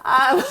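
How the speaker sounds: high, bright laughing fit; real laughter. Real, not acted. Laughing along with her co-host. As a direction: laugh it out, then a little um.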